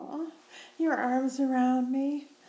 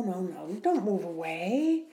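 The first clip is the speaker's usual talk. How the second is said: normal talking voice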